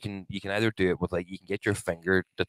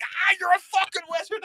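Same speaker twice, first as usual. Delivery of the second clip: very high-pitched mock panic; freaked-out cry. Retelling freaking out at the moving watch, high-pitched. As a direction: squeal it in amazed alarm.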